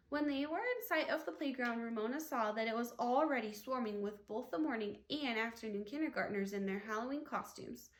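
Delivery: ordinary delivery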